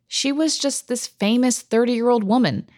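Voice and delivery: ordinary delivery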